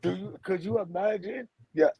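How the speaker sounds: ordinary delivery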